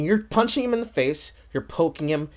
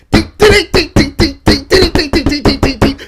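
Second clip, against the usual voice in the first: mock combo hits; 4 semitones higher, 8 dB louder than usual. Imitating fighting game combo hits.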